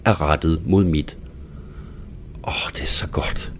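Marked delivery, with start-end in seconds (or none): 2.5-3.5 breathless lower whisper of delight; soft aroused whisper; whispered pleasure. A narrator's reading. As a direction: whisper it softly, overcome with pleasure. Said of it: "siger jeg".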